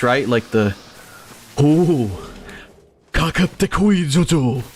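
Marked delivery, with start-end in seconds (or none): 1.6-4.6 higher, slow, smug villain taunt; arrogant anime villain voice. Retold or imitated. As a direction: sneer it like a cocky anime rival. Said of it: "the kind of arrogant sounding anime villain voice".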